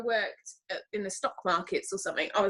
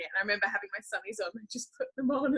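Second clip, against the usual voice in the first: ordinary delivery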